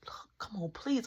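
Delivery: soft unvoiced pleading; whispered plea. Whispering a plea to friend outside. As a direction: beg quietly.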